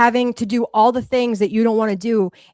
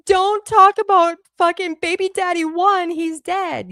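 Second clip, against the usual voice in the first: mock crying; 9 semitones higher, 3 dB louder than usual. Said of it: "you were crying, being like".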